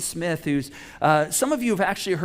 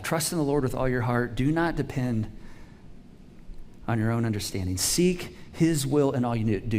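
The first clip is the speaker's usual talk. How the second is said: scripture reading; 5 semitones lower, 3 dB quieter than usual. Acted. Reads scripture aloud.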